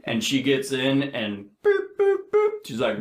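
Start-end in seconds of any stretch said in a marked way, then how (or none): 1.6-2.5 short high-pitched robot beeps; machine boops. Retold or imitated. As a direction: imitate a loader beeping as it steps. Mimics the loader machine.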